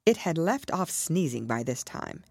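plain reading voice

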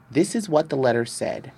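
ordinary delivery, nothing marked

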